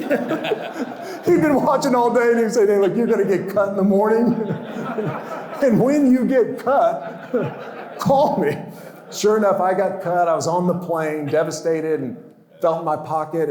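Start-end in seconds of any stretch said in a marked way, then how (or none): 0.0-8.6 high, loud, laughing through the words. Real, not acted. Retelling the coach's prediction while laughing hard. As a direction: tell it while cracking up.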